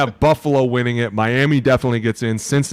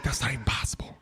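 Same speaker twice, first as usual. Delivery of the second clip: hushed, breathy disbelief; whisper; 10 dB quieter than usual. Retold or imitated. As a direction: whisper it in shock. Quoted self whispering.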